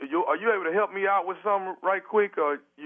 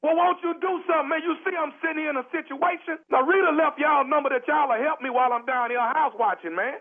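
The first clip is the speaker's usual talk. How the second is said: exasperated; 7 semitones higher than usual. Acted. Stuck caller pleading, exasperated.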